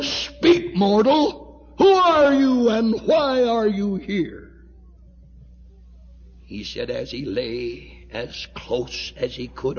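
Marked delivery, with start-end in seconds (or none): 0.0-4.5 high, loud shouted demand from the throne; thundering divine command. Retold or imitated. Voice from the throne commanding the mortal to speak, thundering. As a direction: cry it out as an overpowering command.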